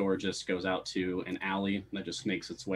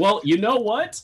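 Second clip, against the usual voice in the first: loud, high-pitched comeback; mock-defensive outburst; 12 semitones higher, 9 dB louder than usual. Real, not acted. Game master answering groans about skeleton guards, loud mock defiance. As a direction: push back loudly, voice jumping up.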